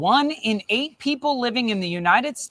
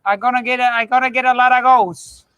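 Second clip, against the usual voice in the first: put-on loud, high Argentinian accent; 5 semitones higher, 6 dB louder than usual. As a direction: brag like Maradona in an Argentinian accent. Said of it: "the Argentinian accent".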